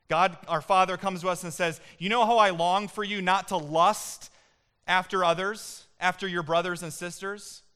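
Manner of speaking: loud, high, fervent sermon delivery; preaching at full force. Acted. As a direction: preach it out to the whole church.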